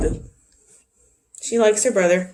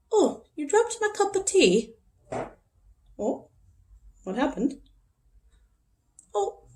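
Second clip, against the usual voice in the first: high-pitched playful British voice for a dog; British accent; 7 semitones higher, 4 dB quieter than usual. Pretend. Owner imagining her dog as a human, playful British accent. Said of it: "like, a British accent".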